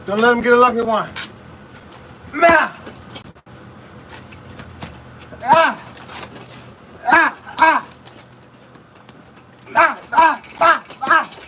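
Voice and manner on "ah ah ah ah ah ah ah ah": short, higher-pitched "ah" with each punch; sharp bursts of effort on every strike; punch exertion cries. Real, not acted. Boxer throwing punches in training, effort cries. Punching through a workout round.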